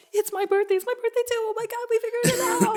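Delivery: loud, very high-pitched squealing; imitated giddy excitement. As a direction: act out two people thrilled to understand each other. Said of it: "both of you were just like".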